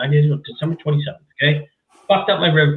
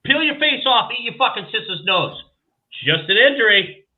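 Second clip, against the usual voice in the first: sarcastic mockery; 7 semitones higher than usual. Mocking the official line.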